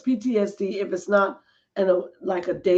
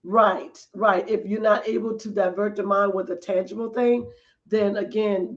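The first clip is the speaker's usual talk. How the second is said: usual speaking voice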